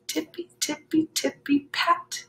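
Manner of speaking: high, hushed tiptoe sound; sneaky bunny steps. A narrator's reading. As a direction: tiptoe the words like tiny paws. Bunnies sneaking in.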